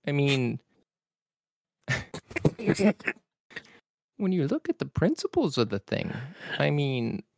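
exasperated professor lamenting the world; Jordan B. Peterson impression. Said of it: "[name]'s impeccable per-, impression".

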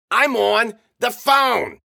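slow, loud, high-pitched yell. A narrator's reading. O'Neill tells the brawlers he's on the phone, slow yell. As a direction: yell it word by word. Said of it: "O'Neill yelled".

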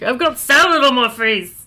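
mock comedian doing a joke; lightly put-on comic catchphrase delivery; Tom Green. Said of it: "one Tom Green joke".

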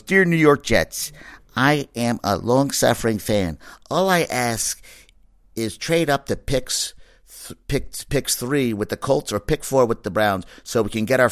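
slow, lightly voiced kid reading out a letter; little kid's letter. Host reads fan's letter to the Jets, mock little-kid recital. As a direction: mimic a child's careful letter-reading, drawn out. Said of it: "like a little kid wrote".

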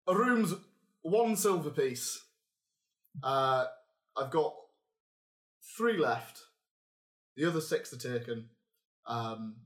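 game master voicing the Northern barkeep to the player; northern accent. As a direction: play the gruff Northern innkeeper. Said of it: "I can do northern".

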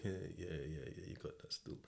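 quiet, fading into a whisper; reluctant going along. Retold or imitated. Friend humouring the mocking inmate, reluctant fading whisper. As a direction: humour him half-heartedly, then drop to a whisper.

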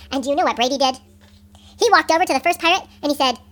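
usual speaking voice

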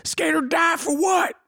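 very high screamed hook; high-pitched drawn-out yell; shouted song hook. Pretend. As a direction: belt it out high, like a song chorus. Let's make that song.

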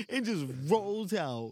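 ordinary talk, nothing marked

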